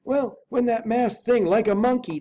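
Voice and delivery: put-on a frightened old butler unsure of what he saw; Poole the butler. Butler tells the lawyer about the masked figure, high and fretful. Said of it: "returned Poole".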